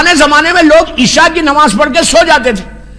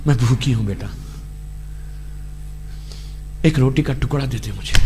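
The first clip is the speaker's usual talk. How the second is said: hushed, much softer plea of a starving mother; hungry mother pleading; 15 dB quieter, faster than usual. Acted. Preacher voicing a hungry mother at her son's door, hushed pleading. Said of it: "اگر ماں بولے کہ".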